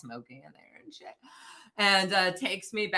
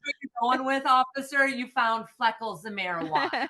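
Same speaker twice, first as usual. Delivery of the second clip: mocking disbelief; 7 semitones higher than usual. Retells mocking the officer's finding.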